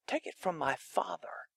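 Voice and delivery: soft whispered aside. Acted. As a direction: hush it, pointing to the Father. Jesus points to the Father.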